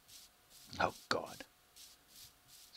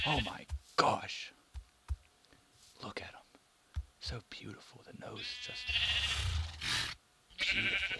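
whispered awe at a beautiful horse; awed whisper. Real, not acted. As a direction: marvel at it under your breath.

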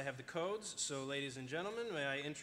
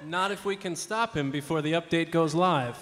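heroic declaration; 14 dB louder than usual. Acted. Heroic vow before the mission.

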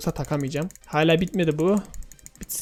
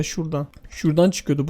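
usual speaking voice

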